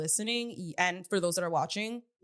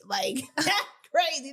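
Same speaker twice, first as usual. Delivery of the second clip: higher and louder, laughing it out; 7 semitones higher, 4 dB louder than usual. Real, not acted. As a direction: laugh through the words. Repeats the remark through a laugh.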